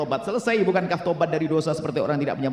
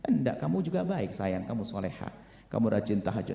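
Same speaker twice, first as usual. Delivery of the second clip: husband's tender reassurance; 6 semitones lower, 7 dB quieter than usual. Acted. Husband voiced comforting wife.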